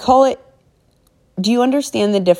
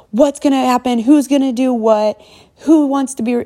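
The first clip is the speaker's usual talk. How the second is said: higher-pitched, acted-out panicked questions; mock panic; 7 semitones higher than usual. Team leader imitating someone panicking when work goes wrong, mock panic. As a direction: fire off worried questions in a raised pitch, play-panicking. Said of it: "to panic, like, super easy to be like".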